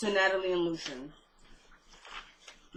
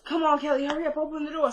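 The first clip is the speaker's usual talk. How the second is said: higher-pitched, lightly acted urgency; urgent plea; 6 semitones higher, faster than usual. Frightened woman begging Kelly to open the door, urgent. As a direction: hurry her to open up, imitating panic.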